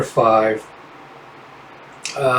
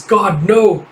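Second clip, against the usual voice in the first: higher cry of despair; anguished no; 6 semitones higher than usual. Acted. Someone watching Thomas seized, higher anguished cry. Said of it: "somebody's like".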